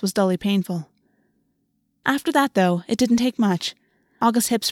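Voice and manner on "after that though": ordinary delivery, nothing marked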